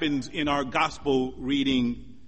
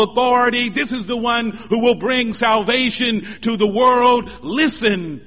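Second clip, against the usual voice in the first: loud, raised, insistent sermon voice; forceful preaching; 8 semitones higher, 8 dB louder than usual. Real, not acted. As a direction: drive each declaration home to the congregation. Preacher builds to full force.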